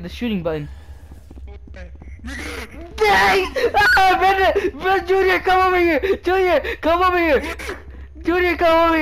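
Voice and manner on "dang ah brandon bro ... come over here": high, loud frantic calls; scared yelling with a laugh; panicked calling out. Real, not acted. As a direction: shout for your friend to come. Scared, calling friend over.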